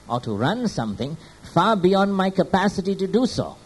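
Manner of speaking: loud, rising preacher's voice driving each point home; preaching at full force. Acted. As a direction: preach it out to the congregation with fervour.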